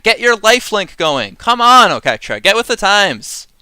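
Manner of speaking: half-joking tirade, pushing hard; mock rant. Podcaster rants at Oketra to become a cat, mock exasperated. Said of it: "Rant over".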